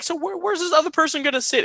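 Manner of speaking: very high, strained question; mock high panic over the seating; incredulous squeak. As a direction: push it way up in disbelief. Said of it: "And I was like".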